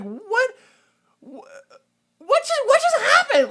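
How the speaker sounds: high, loud baffled what; squeaky mock-bewildered cry; stunned disbelief. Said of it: "my mind blanked".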